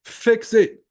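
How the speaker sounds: loud, high command; commanding shout. Real, not acted. Trader ordering struggling viewers to act, loud and high. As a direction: bark the order at them.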